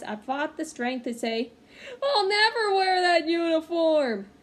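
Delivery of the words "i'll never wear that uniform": loud, high crying wail; tearful protest. Acted. Boy refusing his mother's sweater, crying loudly. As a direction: cry it out through tears, high and loud. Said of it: "With tears in my eyes".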